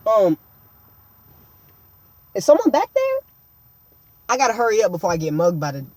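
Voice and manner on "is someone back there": high-pitched questioning voice; mock suspicious call. Calling to whoever is lurking in the back, high suspicious voice.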